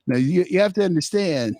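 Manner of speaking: usual speaking voice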